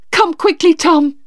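high, alarmed call; urgent. A narrator's reading. Sister fetches brother to their sick father, urgent.

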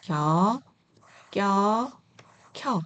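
lax 겨, tense 껴, aspirated 켜; syllable drill. Shown as a demonstration. Teacher showing combined letters to learners, separated 겨, 껴, 켜. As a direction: read 겨, 껴, 켜 apart, each onset distinct. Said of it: "이렇게 읽어주시면 되세요".

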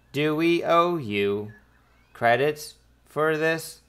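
robot translator spacing out each word; robotic translator voice. Acted. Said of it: "a robotic voice goes".

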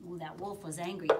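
ordinary delivery

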